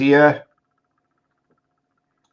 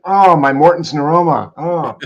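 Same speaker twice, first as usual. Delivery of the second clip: mock pained moaning; 5 semitones higher, 4 dB louder than usual. Host imagines hobbling away from zombies, mock pained moaning. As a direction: moan about a sore foot in jest.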